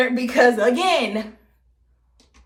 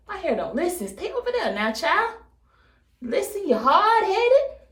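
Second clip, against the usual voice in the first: higher, swooping mock telling-off; playful scolding; 8 semitones higher than usual. Vlogger telling her unruly hair off, mock exasperated scolding. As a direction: scold it like a stubborn child.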